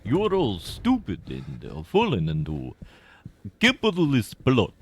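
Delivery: mock a Cold War Soviet in a put-on Russian accent; playful mock Russian accent as a sneering Soviet. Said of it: "Which I don't know why I gave them that accent".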